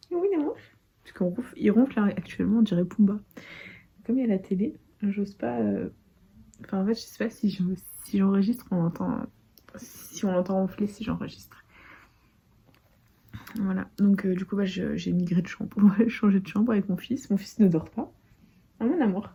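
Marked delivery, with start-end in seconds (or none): 0.1-0.6 high, loud tender coo; doting high coo. Real, not acted. Cooing to her baby. 18.8-19.3 high, loud tender coo; doting high coo. Real, not acted. Cooing to her baby.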